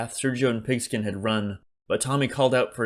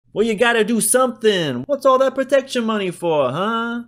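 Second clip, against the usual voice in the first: much higher, louder whining demand; whiny city shopkeeper; 13 semitones higher, 7 dB louder than usual. A narrator's reading. Joe demands Berkeley earn his protection money, whining. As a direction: whine and push back hard. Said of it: "Joe whined in his thick city accent".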